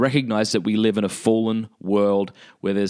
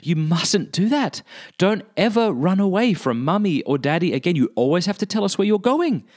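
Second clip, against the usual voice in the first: put-on firm parent to child; 9 semitones higher than usual. Parent telling a found child never to run off, firm and high. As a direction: play the worried parent laying down the rule.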